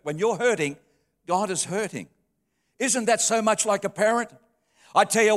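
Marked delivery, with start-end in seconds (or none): none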